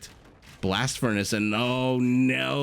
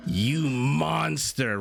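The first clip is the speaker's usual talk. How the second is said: high gasp then slow you monster; shocked, playful scolding; mock outrage; 5 semitones higher, much slower than usual. Real, not acted. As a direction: gasp and drag out the accusation. Horse killed right after praise.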